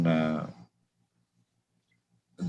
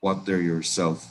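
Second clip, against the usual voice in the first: normal talking voice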